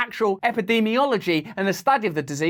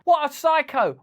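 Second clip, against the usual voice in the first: ordinary talk, nothing marked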